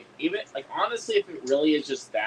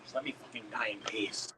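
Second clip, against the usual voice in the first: weary whisper; 12 dB quieter than usual. Pretend. Speaker begging to be left alone after a flub, weary whisper. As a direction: whisper it like a worn-out, mock-dying plea. Mock plea after botching the title.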